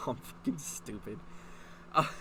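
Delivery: ordinary delivery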